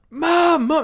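high, loud screamed call for mum; loud high-pitched yell for mum; daughter screaming. Retold or imitated. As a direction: shriek it out high like a panicked daughter. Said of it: "you hear the daughter start to scream".

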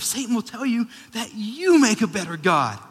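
loud, high, impassioned preacher; full-force preaching. Real, not acted. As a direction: thunder it at the congregation.

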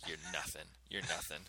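dad spitting on son's shoes, low sneer; sneering put-down. Pretend. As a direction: drop it low and cold.

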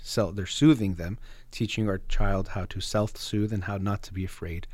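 ordinary talk, nothing marked

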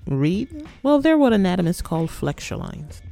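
ordinary talk, nothing marked

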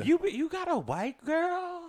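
very high, slow mock disbelief; imitated girlfriend outrage. Girlfriend questioning him about last episode, mock disbelief. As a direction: draw it out in a high imitated female voice. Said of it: "she was getting on me".

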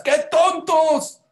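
burlón, agudo y lento, con gritos de desprecio; burla altanera. Retold or imitated. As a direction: se ríen de los que huyen en balsa, muy agudo. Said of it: "que le decía a los de las balsitas". (pasajeros del barco burlándose de los de las balsas, voz aguda y arrogante)

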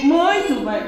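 plain reading voice

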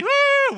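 high loud whoop; whooped demo cry; demo woo. Shown as a demonstration. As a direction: show them the woo. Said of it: "You have to say".

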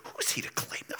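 unvoiced hushed whisper; whispered gossip. Preacher voicing murmuring crowd in a whisper. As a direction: breathe it like a secret complaint. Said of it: "murmur, in a low tone".

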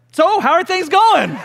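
high-pitched, insistently casual question; feigned casual greeting. Retold or imitated. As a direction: repeat the small talk with forced lightness. Repeats careful question after awkward silence.

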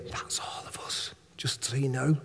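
a quick whispered aside to the room. Real, not acted. Preacher to congregation, whispered aside. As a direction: lean in and whisper it. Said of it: "aside to congregation".

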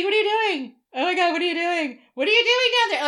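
high, loud, mock-shocked squeal. [name] sees the proposal.

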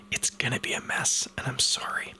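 whispered apology before the pour. Real, not acted. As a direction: hush it, sorry in advance.

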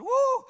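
high, loud "woo"; excited whoop. Retold or imitated. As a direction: whoop like an overjoyed convert. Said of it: "he's excited".

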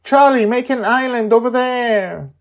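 high, loud excited shout. Acted. Joy shouting to Charlie from the condo, high and loud. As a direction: call out from the flying condo. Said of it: "Joy shouts".

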